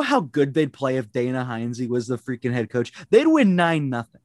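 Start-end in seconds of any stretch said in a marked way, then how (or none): 3.1-4.2 excited. Real, not acted. Excited hockey fantasy.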